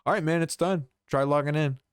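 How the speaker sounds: a hint of a relaxed rep; chill guy. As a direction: toss it off breezily.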